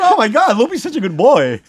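high, mock-gushing cop voice; mocking cop friends. Teasing friend mimics cops gushing over him, high mock praise. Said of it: "They go".

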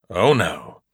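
ordinary delivery, nothing marked

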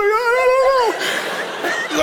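high-pitched wail of a reply; mock crying. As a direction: wail it out as a hammed-up sob. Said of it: "Are you crying again?".

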